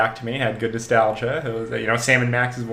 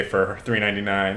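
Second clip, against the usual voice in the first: usual speaking voice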